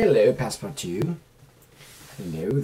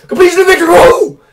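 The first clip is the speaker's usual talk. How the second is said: much higher, louder victory whoop; triumphant cheer; 19 dB louder, faster than usual. Real, not acted. Declaring the cats' victory, loud triumphant cheer. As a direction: shout it like a win. Celebrating cats win.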